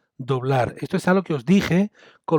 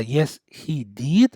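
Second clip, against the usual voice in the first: Yes, he did spoken very slowly; slow model answer; much slower than usual. Shown as a demonstration. Teacher modelling the yes answer slowly for students. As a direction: model the short answer at a crawl. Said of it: "La respuesta sería".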